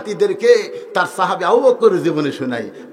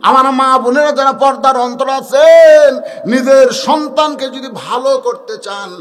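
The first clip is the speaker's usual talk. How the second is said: slow, louder, higher sermon appeal; preaching at full force; 6 semitones higher, 9 dB louder, slower than usual. Acted. Preacher appealing to the mothers in the audience, full-force preaching.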